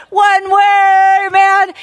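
loud, high "One way, man" like a rally cry; shouted slogan. Retold or imitated. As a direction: call it out to the crowd. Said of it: "we'd always go".

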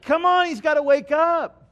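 high, loud frantic plea; panicked disciples. Acted. Disciples in the storm, frantically urging each other. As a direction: panic like a sinking sailor.

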